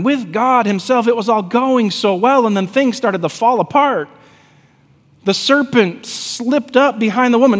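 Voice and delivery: high-pitched, driving preacher's storytelling; impassioned preaching. Acted. Preacher retells Eden and the serpent, impassioned preaching. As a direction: preach it urgently, pressing on without letup.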